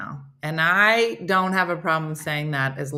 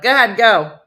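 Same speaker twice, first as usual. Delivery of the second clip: louder, higher prompt to start; brisk higher-pitched go-ahead; casting director cue; 4 semitones higher, 9 dB louder than usual. Acted. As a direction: cue them to go, voice up. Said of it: "and they're like".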